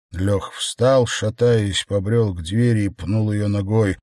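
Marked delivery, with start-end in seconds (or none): none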